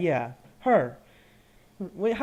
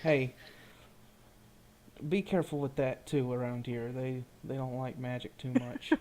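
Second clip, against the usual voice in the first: much softer, leaned-in murmur; hushed warning; 8 semitones lower, 12 dB quieter than usual. Acted. Companion quietly warns the wizard about magic, hushed. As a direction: keep it low so the town won't hear. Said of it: "I'm also gonna lean in and say".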